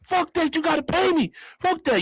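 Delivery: high-pitched acted demand; indignant attitude. Crashed passenger to driver, high indignant demand. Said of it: "This my attitude at the time".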